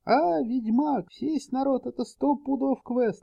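high, eager gamer voice; fast excited onlooker; excited crowd voice. A narrator's reading. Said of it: "раздался голос из толпы".

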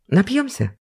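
high, hopeful little question. Acted. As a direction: ask with eager hope. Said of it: "с надеждой спросила она".

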